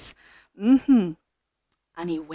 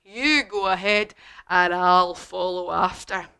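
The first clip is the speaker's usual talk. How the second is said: slow, put-on monster voice; loud first word, then slow indulgent monster; amused Gruffalo; 6 dB louder than usual. Gruffalo humouring the wee mouse, slow monster voice. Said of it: "So he says".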